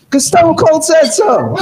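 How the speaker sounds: mock rally shout.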